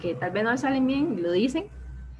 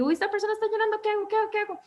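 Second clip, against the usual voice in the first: high, flustered voice, much higher; a higher, louder flustered "what do I do"; anxious panic; 11 semitones higher, 3 dB louder, much faster than usual. Retold or imitated. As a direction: voice someone panicking at tears. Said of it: "una congoja o una, una sensación como de angustia".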